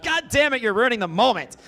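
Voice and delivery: high, loud mock tantrum; exasperated yell. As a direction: shriek it at mom in frustration. Frustrated at mom.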